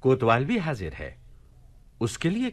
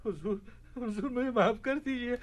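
much higher, desperate begging for mercy; frightened pleading; 10 semitones higher, 5 dB quieter than usual. Acted. The police chief begs the caliph for mercy in fear. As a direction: plead in panic for your life.

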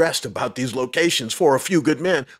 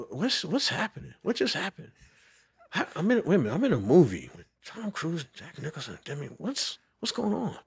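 softer, dazed musing to himself; stunned inner thoughts; 3 semitones higher, 8 dB quieter than usual. Retold or imitated. At home by the window after the offer, soft stunned musing. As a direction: wonder aloud, quietly, in disbelief.

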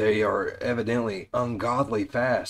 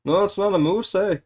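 higher, louder mock Canadian accent; 8 semitones higher, 3 dB louder than usual. Friend joking as Canadian wife, mock Canadian accent.

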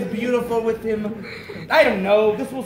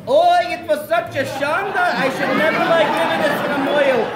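put-on higher Yiddish accent, grandma kvetch; Yiddish grandmother accent; 5 semitones higher, 5 dB louder than usual. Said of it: "[name] looks like a Yiddish grandmother".

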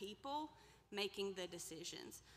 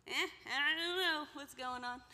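mock clueless, higher and louder; careless shrug; 7 semitones higher, 6 dB louder than usual. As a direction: play the market shrugging off all responsibility. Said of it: "the farmer's market can just go".

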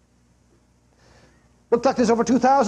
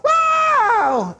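very high, drawn-out holler; re-enacted high-pitched yell; imitated yell. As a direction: mimic a man yelling out as he falls. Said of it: "let out a yell".

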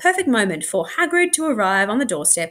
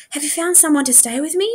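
put-on anxious child; 7 semitones higher than usual. A narrator's reading.

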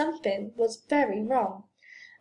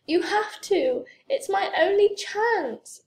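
high-pitched, urgent plea; begging with raised pitch; 9 semitones higher, 3 dB louder than usual. A narrator's reading. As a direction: plead as if it's your last hope. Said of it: "Franklin begged".

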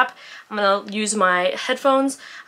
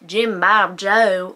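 mock southern drawl; 5 dB louder, much slower than usual. Mocking bad audiobook southern voices, slow drawl. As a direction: ham up a fake southern accent. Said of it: "They don't sound like".

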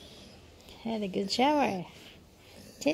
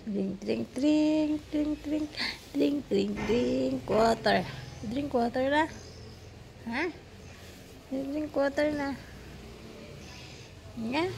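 coaxing; 5 semitones higher than usual. Real, not acted. Coaxing dog to drink.

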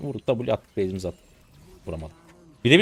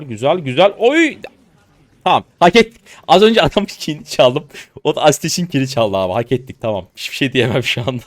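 shouting, thrilled, and much louder than before; loud excitement; 4 semitones higher, 10 dB louder than usual. Real, not acted. Streamer whooping to viewers after stealing a kill, loud and excited. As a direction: yell it out after winning the fight. Celebrating a won fight on stream.

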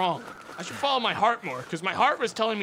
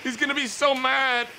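mock panic; 8 semitones higher, 4 dB louder than usual. Real, not acted. Enemy about to arrive, panic.

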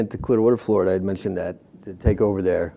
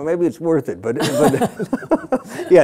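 laughing; 6 semitones higher, much slower than usual. Real, not acted. Joking about risking it.

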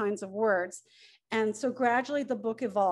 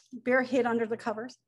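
ordinary delivery

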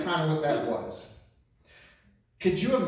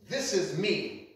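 usual speaking voice

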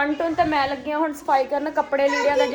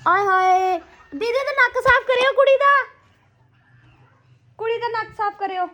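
dramatic loud aunty; 8 semitones higher, 4 dB louder than usual. Real, not acted. Calling out to family about child.

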